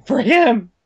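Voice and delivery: hammed-up heroic outcry; [name]. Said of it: "she points at the empty bed".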